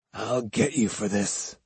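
low, soft, through clenched teeth; muttered threat. Acted. Hidden student vowing revenge from among the trees, muttering through clenched teeth. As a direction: mutter it under your breath, vengefully. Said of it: "muttered through clenched teeth".

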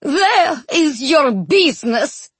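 high, slow accusation; drawn-out, indignant retort; furious wife. A narrator's reading. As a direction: throw it back at him sharply.

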